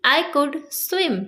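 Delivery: very slow, carefully spaced English words; slow teaching demo. Shown as a demonstration. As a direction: dictate it word by word to a learner. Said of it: "example देखिए".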